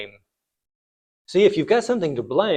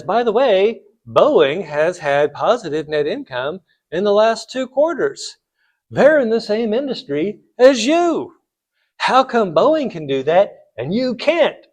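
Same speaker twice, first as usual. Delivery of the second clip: raised, emphatic voice, acting a challenger; pointed challenge; 7 semitones higher, 6 dB louder than usual. Alan challenging the French CEO before the board, pointed challenge. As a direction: press the point higher and louder.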